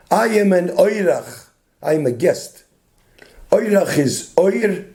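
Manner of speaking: ordinary delivery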